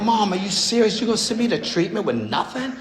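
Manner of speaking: can't believe she'd do this; incredulous plea. Retold or imitated. Pleading with mom, shocked.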